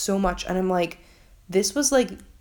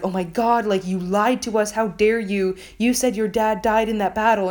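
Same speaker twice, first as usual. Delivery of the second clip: hammed-up group accusation, slightly raised; mock outrage; 3 semitones higher, 3 dB louder than usual. Crewmates confront him about his dad, mock outraged. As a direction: imitate scandalised crewmates confronting a liar.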